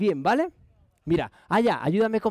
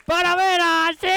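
excited cheering; 11 semitones higher, slower than usual. Real, not acted. Magician celebrating trick success.

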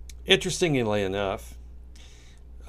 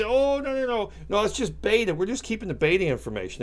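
much higher, mock-innocent denial; mocking officials; 10 semitones higher than usual. Officials denying they spy on phone calls, mocking high denial. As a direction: play the government official brushing it off, high and fake. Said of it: "And they say".

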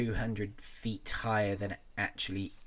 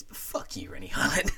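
laughing jab; 8 semitones higher than usual. Real, not acted. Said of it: "jokey jab at director".

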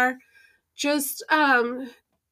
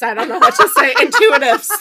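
louder, laughing through words; 8 semitones higher, 8 dB louder than usual. Real, not acted. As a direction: giggle out the words. Laughing at own phrasing.

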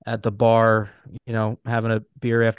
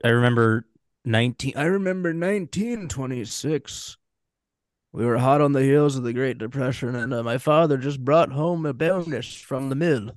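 mock old-timer reminiscing; 2 semitones higher than usual. Joking to co-hosts about remembering the Depression, mock nostalgic.

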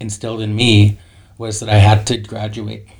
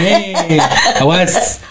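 put-on laughing, higher Res accent greeting; 7 semitones higher, 5 dB louder than usual. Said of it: "get my Res accent on".

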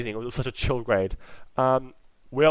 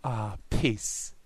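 ASMR voice. Real, not acted. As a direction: murmur each word like ASMR. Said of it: "Sorry, I'm gonna stop".

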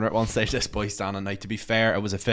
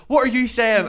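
higher, louder mock lad; mock aggressive retort; 13 semitones higher, 8 dB louder than usual. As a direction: bark it back like a riled lad. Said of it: "he goes".

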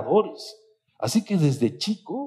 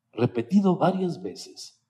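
ordinary talk

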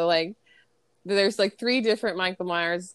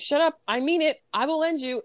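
higher-pitched mock threat; acted exasperated warning; super frustrated; 8 semitones higher than usual. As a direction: imitate a cook at the end of his patience. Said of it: "he's super frustrated".

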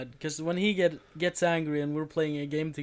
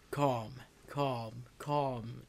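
lower, softer mock calming chant; mock soothing; 5 semitones lower, 8 dB quieter, much slower than usual. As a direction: imitate a patronizing calm-down. Mocking friend's calming tactics.

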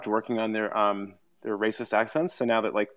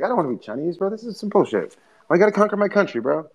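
high-pitched mock complaint; high, hammed-up indignant rant; mock indignant whine; 8 semitones higher than usual. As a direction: whine like a fed-up American. Imitating indignant American.

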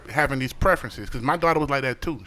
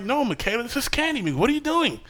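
coaxing dad; 9 semitones higher than usual. Retold or imitated.

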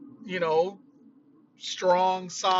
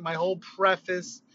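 ordinary delivery

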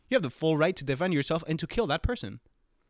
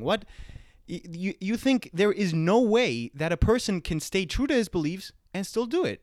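normal talking voice

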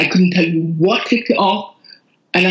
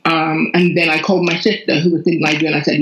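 usual speaking voice

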